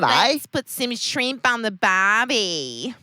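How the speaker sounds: slow, loud fake Australian; hammed-up Australian catchphrase; terrible Australian accent. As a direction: stretch it out in bad Australian. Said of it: "having a terrible accent".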